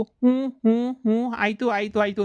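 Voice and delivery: lightly mimicking an over-eager yes-sayer; mock meek agreement. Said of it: "ಅಂದ್ಬಿಡ್ತಾಳಲ್ಲ".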